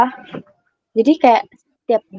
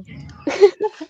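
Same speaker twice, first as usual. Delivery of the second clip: high laugh; 10 semitones higher than usual. Real, not acted. Laughing at her own joke.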